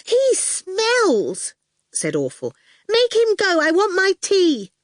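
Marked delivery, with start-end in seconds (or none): 0.0-1.5 put-on high, pert child voice; bossy little girl. A narrator's reading. Little sister orders the goon out, high and bossy. 2.9-4.7 put-on high, pert child voice; bossy little girl. A narrator's reading. Little sister orders the goon out, high and bossy.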